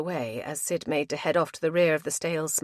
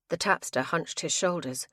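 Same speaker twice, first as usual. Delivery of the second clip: ordinary delivery, nothing marked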